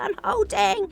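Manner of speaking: high, loud, lightly voiced reply to a friend; voicing the friend. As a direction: answer brightly like someone holding the door for you. Retold reply from friend Noakes.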